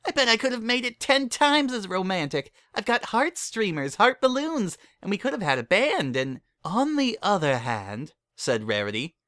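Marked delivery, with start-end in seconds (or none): 0.0-6.4 bouncy indignant squeal; high excited filly voice; Pinkie, indignant. A narrator's reading. 6.6-8.1 slow, slightly raised lady; a musing refined turn; light lady voice, drawn out; Rarity's voice. A narrator's reading.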